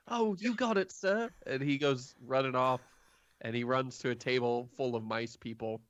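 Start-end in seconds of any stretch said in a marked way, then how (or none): 0.1-1.3 high, small timid voice; mouse person voice. Acted. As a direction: squeak it up like a shy mouse. Mouse person answers eagerly.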